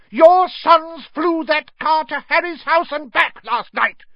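high, loud, furious yell; shouting. A narrator's reading. Wife telling husband of sons' misdeed, furious shout. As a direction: hammer out each word at top pitch. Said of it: "shouted Missus Weasley".

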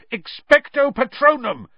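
high, loud spell shout; yelled spell. Acted. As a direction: yell the spell. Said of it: "Harry yelled".

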